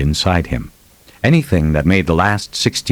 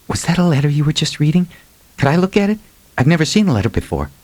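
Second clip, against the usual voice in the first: put-on much higher, fast and eager young girl; eager young woman; 10 semitones higher, faster than usual. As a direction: ask him brightly, curious and quick. Abby voiced by narrator.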